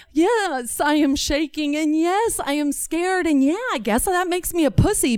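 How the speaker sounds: high, insistent concession; defiant admission. Acted. Child answering Dad's taunt about being scared, defiant admission.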